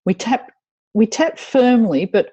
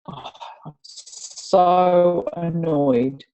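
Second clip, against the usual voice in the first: slow, heavy setup statement for the group to repeat; slow tapping statement; slower than usual. Shown as a demonstration. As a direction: drag it out, letting the frustration show a little. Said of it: "repeat these after me".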